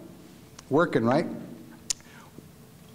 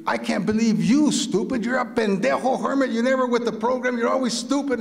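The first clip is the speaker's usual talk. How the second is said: high-pitched indignant scolding; imitating someone yelling insults; retold angry yelling; 10 semitones higher than usual. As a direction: berate them in an acted, high-pitched rant. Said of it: "yells at me, right?".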